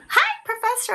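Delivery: high-pitched mock student greeting; imitating eager students. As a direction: chirp it like a student popping up. Voicing students who show up.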